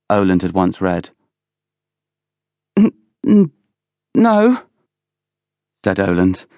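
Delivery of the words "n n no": high, halting, scared stammer; frightened stammer. A narrator's reading. Oland denies spying to Villiers, stammering in fear. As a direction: stumble on the word in fright. Frightened boy answers roaring accuser.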